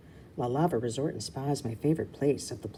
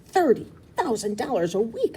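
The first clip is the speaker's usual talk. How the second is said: high, loud disbelieving echo; stunned echo; 7 semitones higher, 6 dB louder than usual. Acted. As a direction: repeat it in shock. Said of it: "I echo".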